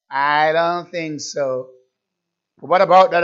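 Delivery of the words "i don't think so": slow, low, softer doubting reply; voiced unbelief. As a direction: drag it out as an unbeliever would.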